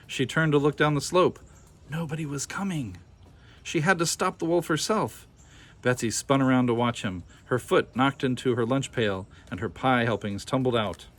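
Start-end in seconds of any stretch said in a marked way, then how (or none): none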